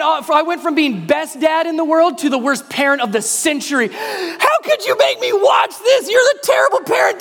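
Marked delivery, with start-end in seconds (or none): 3.9-7.2 a shrill mock-outraged child yelling at his father; screaming kid. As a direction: wail at dad like a betrayed kid. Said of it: "just started screaming".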